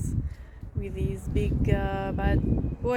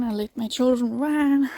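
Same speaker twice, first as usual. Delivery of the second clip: ordinary delivery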